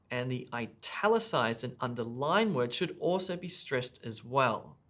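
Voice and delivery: ordinary talk, nothing marked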